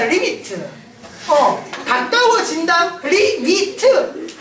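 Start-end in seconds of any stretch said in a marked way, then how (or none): 3.0-4.3 리미트 drawn out very slowly; slow, separated syllables on 리미트; syllable-by-syllable reading. Shown as a demonstration. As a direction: sound out 리, 미, 트 one by one. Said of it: "이렇게 읽습니다".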